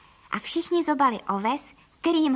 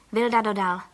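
plain reading voice

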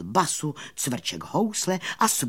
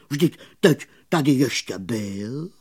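droning, baffled bumblebee voice; surprised mumbling bumblebee; 4 dB louder than usual. A narrator's reading. Bumblebee baffled by the disappearance, surprised mumbling. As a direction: mumble it in disbelief. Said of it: "huhlal překvapeně čmelák".

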